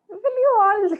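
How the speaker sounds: half-laughing shout to make them stop; exasperated outburst. Real, not acted. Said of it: "okay, stop triggering, triggering me".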